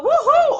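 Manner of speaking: high gleeful woohoo; squealing, delighted woohoo; excited whoop. Retold or imitated. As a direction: whoop it up like you can't wait.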